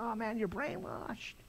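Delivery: a touch of high mocking disbelief; mocking friends. Friends scoffing at a new believer, mild mock disbelief. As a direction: toss it off like scoffing buddies. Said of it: "all my friends came and said".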